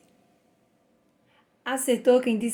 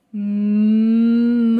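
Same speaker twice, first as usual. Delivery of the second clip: louder, drawn out sound by sound: Mala; sounded-out reading; 7 dB louder than usual. Shown as a demonstration. Teacher sounds out a word with pupils, louder and drawn out. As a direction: blend the letter sounds slowly into Mala. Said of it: "Vamos fazer o sonzinho das letrinhas".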